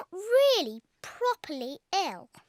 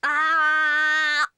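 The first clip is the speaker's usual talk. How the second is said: loud ah; 5 semitones lower, 5 dB louder than usual. Shown as a demonstration. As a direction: mouth wide open, a loud long ah. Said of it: "show George how to say, "Ah".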